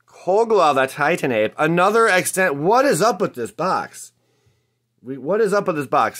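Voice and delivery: high, loud and incredulous; can't believe what the box is giving you; astonished card-opener hype; amazed excitement. Real, not acted. Amazed by repeated rare pulls.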